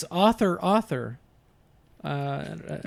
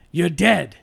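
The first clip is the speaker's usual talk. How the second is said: louder menacing Pacino line; Pacino big boy voice; 5 dB louder than usual. Shown as a demonstration. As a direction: growl it like tough Pacino. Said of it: "especially big boy voice".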